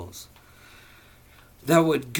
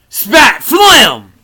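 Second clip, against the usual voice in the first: loud spit sound; 10 semitones higher, 19 dB louder than usual. Acted. Acting out spitting noise.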